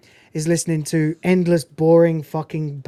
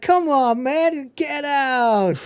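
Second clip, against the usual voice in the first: pleading; 8 semitones higher, much slower than usual. Retold or imitated. Stifler's brother pleading.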